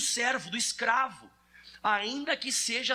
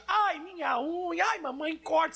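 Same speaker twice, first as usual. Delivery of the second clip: higher, slow mock whimper; whiny child; 6 semitones higher than usual. Preacher mocking an adult whining to mom, higher and slow. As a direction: play a grown man whining like a kid. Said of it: "Ou você acorda de manhã assim".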